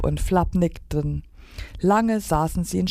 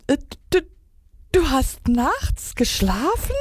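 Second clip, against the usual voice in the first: high, halting disbelief; incredulous blurted question; blurting out; 10 semitones higher than usual. A narrator's reading. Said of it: "stieß ein anderer hervor".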